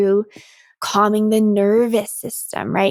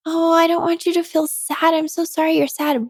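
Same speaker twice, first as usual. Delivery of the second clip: high, cooing fake-sympathetic voice; mock sympathy; 7 semitones higher than usual. Coach imitating people who placate your sadness, high and cooing. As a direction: coo it like someone patting you on the head.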